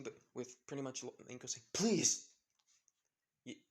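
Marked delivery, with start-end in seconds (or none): 1.7-2.3 loud, high burst of pleading; desperate begging. A narrator's reading. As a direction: cry out as a desperate last plea. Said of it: "begs her in desperation".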